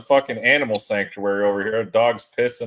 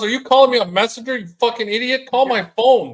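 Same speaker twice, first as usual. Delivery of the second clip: high, loud mock annoyance; imitating an irritated friend; annoyed rant; 10 semitones higher, 3 dB louder than usual. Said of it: "He goes".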